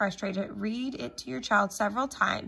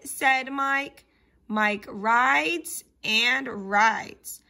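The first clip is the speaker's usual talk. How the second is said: slow, drawn-out word-by-word reading; deliberate early-reader pace with stretched vowels; slow read-along; much slower than usual. Shown as a demonstration. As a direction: read slowly for children sounding out the words. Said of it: "read them a little bit slowly".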